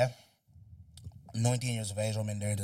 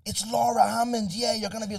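gossiping friend; 11 semitones higher, 7 dB louder than usual. Retold or imitated. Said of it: "Her friend got on".

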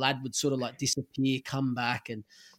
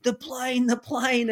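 mock yell; 10 semitones higher, slower than usual. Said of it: "he starts screaming".

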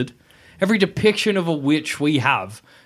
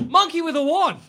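excited gasp; 11 semitones higher than usual. Real, not acted. Gasps remembering the monkey scene.

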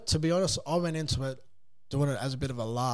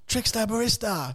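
mock scream; 7 semitones higher than usual. Bloke in fishing section hailing the host, mock yell. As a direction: imitate someone calling down a shop aisle. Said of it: "screaming up to me".

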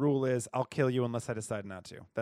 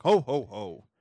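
jolly Santa laugh; 2 semitones higher, 9 dB louder, slower than usual. Pretend. Player tries another Santa voice, hearty and loud. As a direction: belt out a big Santa laugh. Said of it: "What does he sound like?".

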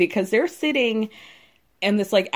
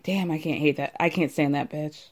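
low, softer aside under the breath; overheard grumble; 7 semitones lower, 6 dB quieter than usual. Retold or imitated. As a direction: imitate someone grumbling to himself as he leaves. Imitates man overheard grumbling while leaving.

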